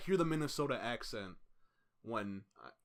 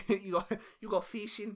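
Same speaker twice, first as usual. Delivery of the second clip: high, laughing Minnesota voice; mock Minnesota fishing line; Minnesota accent; 7 semitones higher, 4 dB louder than usual. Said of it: "That's a Minnesota accent".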